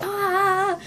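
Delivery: high, sustained fan "ah"; re-enacted thrilled scream; delighted squeal. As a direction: squeal along with excitement. Squealing at the favourite song.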